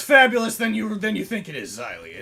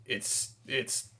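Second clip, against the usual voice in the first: usual speaking voice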